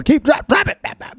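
high-pitched mock cop yell with gunshot sounds; mock cop order. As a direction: bark the order then make shots. Imitating cop ordering drop it.

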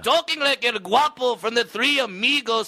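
hammed-up Mexican accent, high and loud; mock Mexican accent. Comic mocking the heckler in a thick Mexican accent. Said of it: "Tone down the Mexicana accent".

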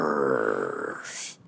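low, drawn-out growling snarl; creature growl. Acted. Game master voices the goblin turning on players, low growl. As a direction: growl it low like a turned pet. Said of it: "starts growling at you".

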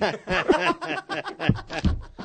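a high, breathy burst of laughter at his own joke. Real, not acted. Host cracking up at his own airport prank, laughing high. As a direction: laugh hard at the punchline.

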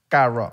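CARRO with an untrilled, softened R; R sin vibrar. Retold or imitated. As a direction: say CARRO without rolling the R. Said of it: "yo no pronunciaba la R". (carro con la erre sin vibrar)

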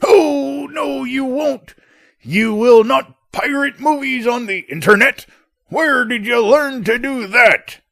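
father outraged at piracy; shocked father. Acted. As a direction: catch the son out. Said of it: "playing both parts of the father".

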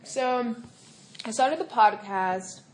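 talking while chewing food; mouth full. Real, not acted. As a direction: speak through a mouthful. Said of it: "Sorry, I'm eating a nugget".

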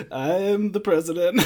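put-on half-hearted Daniel Day-Lewis Lincoln accent; Lincoln accent attempt. Host retrying the line in a mock Lincoln accent. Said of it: "I'm so mad you didn't do the, the accent".

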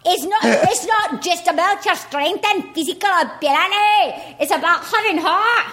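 high Scottish brogue, laughing; Scottish accent. Retold or imitated. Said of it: "You nailed it".

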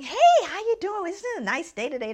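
high, bright, chatty cashier greeting; friendly chirpy greeting. Retold or imitated. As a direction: lean into a sunny cashier voice. Checker chatting cheerfully with Kathleen.

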